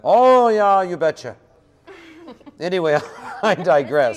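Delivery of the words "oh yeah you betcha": loud, hammed-up Minnesota accent. Host playing up Minnesota for the guests, broad Minnesota accent. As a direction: lean hard into a cartoon Minnesota accent. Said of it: "You do that accent for every state".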